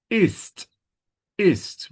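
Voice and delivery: IST high, with a crisp released T; high, isolated IST ending on a sharp T; clear T sound. Shown as a demonstration. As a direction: hit the final T of IST. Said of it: "the T sound is important here".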